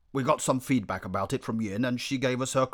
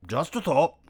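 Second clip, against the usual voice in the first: higher, louder, tossed off; breezy exit; 6 semitones higher, 5 dB louder than usual. A narrator's reading. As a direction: throw it back casually while leaving. Lion leaves over shoulder.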